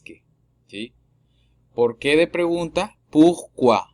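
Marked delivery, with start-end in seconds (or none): 3.1-3.8 Pourquoi said loud as pukwa, falling; French pourquoi. Shown as a demonstration. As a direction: stress Pourquoi as pu-kwa. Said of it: "P U R".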